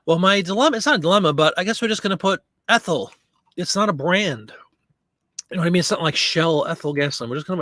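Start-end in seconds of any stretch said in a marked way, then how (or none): none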